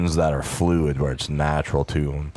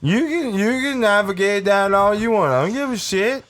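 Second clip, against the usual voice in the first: squeaky high cartoon voice; goofy high voice; 12 semitones higher, 3 dB louder than usual. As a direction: pitch it high and silly. High goofy voice pitching movie.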